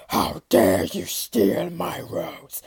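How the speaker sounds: slow, menacing whisper; beast's whispered growl. Acted. Beast menacing the rose thief, slow whispered threat. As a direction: hiss it low like a threat. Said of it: "it growled".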